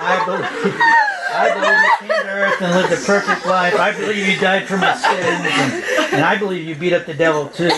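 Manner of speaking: can't stop laughing as he tells it; laughing through the quote. Real, not acted. Dad retelling the toddler's prayer from the back seat, laughing.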